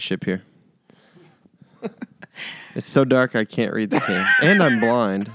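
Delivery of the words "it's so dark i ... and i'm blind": laughing while squinting at the can. Real, not acted. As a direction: laugh through the words.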